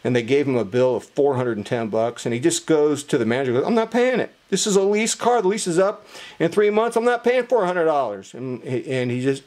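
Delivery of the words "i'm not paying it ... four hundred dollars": higher-pitched imitation of an indignant customer; mildly acted protest, pitched up; indignant refusal. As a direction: act out a friend digging in his heels.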